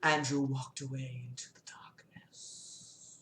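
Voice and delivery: hushed whispered narration; fading whisper. A narrator's reading. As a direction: breathe the line out as a whisper. Closing narration.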